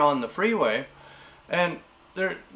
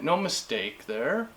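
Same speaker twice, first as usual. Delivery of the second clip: normal talking voice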